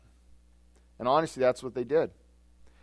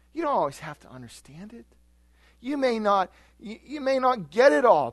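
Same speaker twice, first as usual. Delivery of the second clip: reassuring Jesus; 9 semitones higher, 4 dB louder than usual. Retold or imitated.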